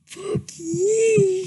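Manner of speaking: normal talking voice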